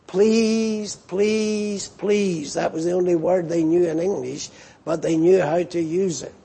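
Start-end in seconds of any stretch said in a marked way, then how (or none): 0.1-2.5 drawn-out, raised plea, mildly imitated; imitated begging plea. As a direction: draw out each word like someone begging. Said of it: "they were begging".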